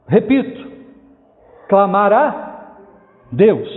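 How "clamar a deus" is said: long pause before "Deus"; emphatic repetition. Real, not acted. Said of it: "Por que que eu tô enfatizando isso?".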